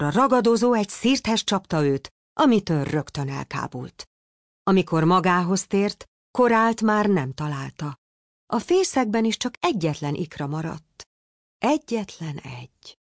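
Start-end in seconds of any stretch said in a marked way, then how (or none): none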